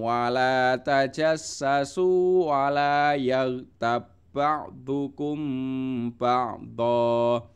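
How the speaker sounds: slow, chanted recitation with held vowels; chanted Quran reading. Acted. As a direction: recite the verse together with the class, slowly. Leads joint recitation.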